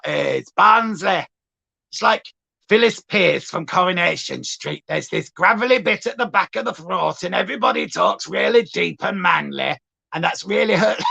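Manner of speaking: gravelly-style Barnsley imitation from the throat; deep Barnsley voice. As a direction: push it to the back of the throat, Barnsley. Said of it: "Barnsley was always like".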